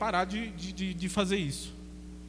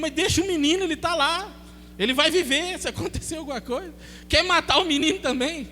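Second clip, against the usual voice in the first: urgent scolding; 7 semitones higher than usual. Retold or imitated. Speaker scolds the panicked woman.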